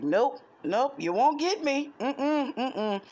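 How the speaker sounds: high, loud teasing no; sing-song taunt, dodging; playful teasing refusal. Retold or imitated.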